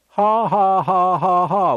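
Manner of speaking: high, deliberate ha-has; defiant mock laugh. Shown as a demonstration. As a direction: laugh pointedly in the devil's face. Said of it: "You laugh at the devil".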